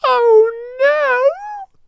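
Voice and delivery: high, wailing mouse squeal; frightened squeak. A narrator's reading. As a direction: wail it as a tiny scared mouse. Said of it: "squeaked the little mouse".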